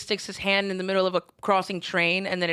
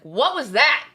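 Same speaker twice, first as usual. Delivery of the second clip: louder, higher startled question; mock outburst; 5 semitones higher, 6 dB louder than usual. Co-host replaying reaction to partner's emotions, loudly. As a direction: throw it out loud, as if baffled. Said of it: "and I'm like".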